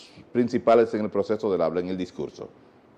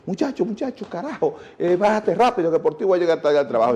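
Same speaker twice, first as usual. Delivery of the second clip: stressed parent yelling; 7 semitones higher than usual. Retold or imitated. Father scolding his child at the school gate, angry high yell. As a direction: push the kid out of the car in a rage. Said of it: "le inoculaban todo ese estrés".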